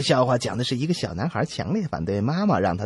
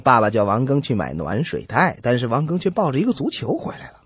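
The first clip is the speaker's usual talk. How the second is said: normal talking voice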